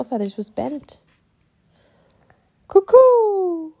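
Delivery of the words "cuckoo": high, loud mock cuckoo; boy calling in bird language. A narrator's reading. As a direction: hoot it out like a bird. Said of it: "Teddy said loudly".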